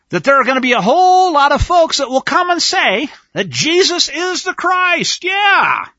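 high, loud preacher's cry; loud proclamation. Acted. Preacher mimics crowds who claim Christ, loud high proclamation. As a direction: proclaim it at full voice, stretching "Christ".

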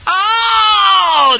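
long, high, loud acted wail; hammed-up wail of desperation. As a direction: cry out in a drawn-out howl. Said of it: "they wailed, they cried".

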